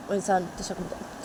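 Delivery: usual speaking voice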